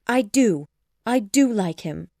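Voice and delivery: loud, emphatic avowal; earnest insistence. A narrator's reading. Elizabeth assures her father, earnest insistence. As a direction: insist from the heart.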